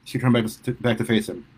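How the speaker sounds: usual speaking voice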